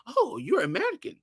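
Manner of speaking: high, surprised "oh, you're American"; mock surprised. Waiter recognizing American accent.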